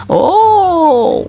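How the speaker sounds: long, high-pitched "oh" of surprise; high drawn-out exclamation; amazed ooh. Real, not acted. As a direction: draw out a high, impressed ooh. Reacts to what appears in game.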